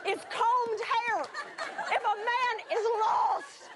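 high, drawn-out indignant plea; hammed-up outraged question; exasperated protest. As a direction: argue back in disbelief. Acted argument with God.

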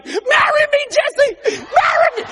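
pleading woman. Retold or imitated. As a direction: beg him to marry you, hammed up. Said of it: "she would run after me".

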